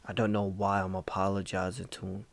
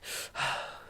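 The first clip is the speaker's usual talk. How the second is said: acted heavy panting after a scream; panicked gasping; 7 dB quieter than usual. Storyteller waking his dog with a scream, gasping. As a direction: reenact gasping for breath. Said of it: "I scream, I'm like".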